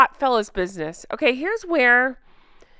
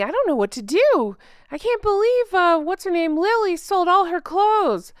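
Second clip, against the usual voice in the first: higher, flustered mock Charlotte voice; Charlotte in a tizzy; 6 semitones higher than usual. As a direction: play her panicking in a fluster. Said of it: "Charlotte's all in a tizzy".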